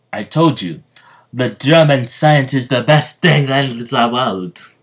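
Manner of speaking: put-on a fumbled try at a German accent for Stroheim's boast. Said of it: "I don't know how to do a German accent".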